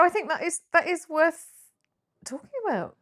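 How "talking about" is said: normal talking voice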